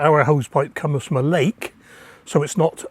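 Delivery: ordinary talk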